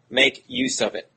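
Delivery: ordinary delivery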